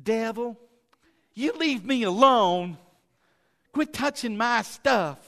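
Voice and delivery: weak pleading. Shown as a demonstration. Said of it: "you can't just".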